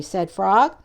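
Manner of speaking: plain reading voice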